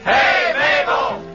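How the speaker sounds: ordinary talk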